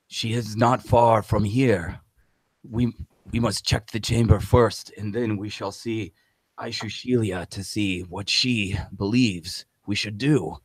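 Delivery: lightly voiced solemn guide; NPC voice. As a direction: speak as a grave guide.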